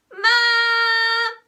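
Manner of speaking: long, high, very loud shriek; piercing child's cry for help. A narrator's reading. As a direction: shriek for Mum, holding the note. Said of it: "shrieked Peter".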